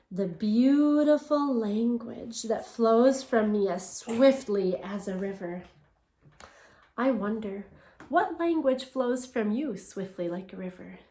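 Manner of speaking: ordinary delivery, nothing marked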